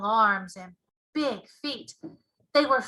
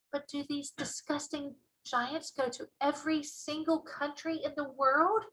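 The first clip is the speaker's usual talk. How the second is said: put-on a little girl's softer, hushed question; lightly voiced young girl, softer and wondering; Sophie voice; 8 dB quieter than usual. A narrator's reading. A small girl asks the giant, in a softer voice.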